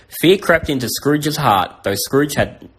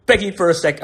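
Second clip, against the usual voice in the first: narration slipping into impression, higher; Michael Caine; 5 semitones higher, faster than usual. Storyteller slips by mistake from narration, half-done Michael Caine voice. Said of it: "I almost did the Michael Caine voice".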